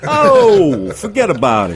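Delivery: put-on thick New York accent, big and loud. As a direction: play up the Brooklyn New Yorker. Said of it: "the New York accent is disappearing".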